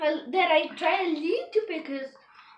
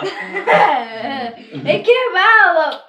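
drawn out through laughter; laughing; 6 dB louder, much slower than usual. Real, not acted. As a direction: say it while cracking up. Laughing at candy falling.

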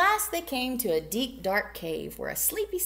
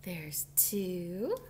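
whisper; 4 semitones lower, 6 dB quieter than usual. Real, not acted. Whispered counting.

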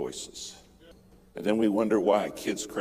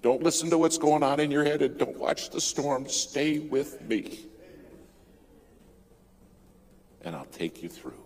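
steady reassuring voice; 6 semitones higher than usual. Retold or imitated. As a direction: hold him steady through the storm. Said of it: "All I can remember is that voice saying".

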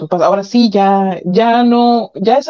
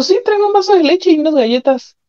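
higher, pushier repeat of the order; impatient repeat; 9 semitones higher than usual. Retold or imitated. Husband repeats his order when she answers "¿Qué?", impatient. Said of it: "Me dijo".

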